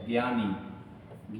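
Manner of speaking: ज्ञानी said very slowly and softly; slow drawn-out word. Shown as a demonstration. Teacher showing ज्ञानी slowly to students, soft and drawn out. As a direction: stretch ज्ञानी out, quiet and slow. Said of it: "ये अशुद्ध है".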